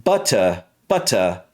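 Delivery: British "Butter", crisp T and open ending; British accent. Shown as a demonstration. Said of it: "in the British version".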